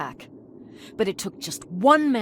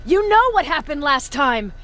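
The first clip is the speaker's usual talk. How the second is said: loud, heated protest; angry outburst; 8 semitones higher, 8 dB louder than usual. Acted. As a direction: push back hard at your superior. Commander angrily arguing with superior.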